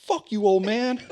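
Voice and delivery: high, loud jeering insult; mock taunt. Teen heckling a stranger at the fair, mocking jeer. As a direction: sneer it like a cocky teen. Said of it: "one of the dudes looks and he's all".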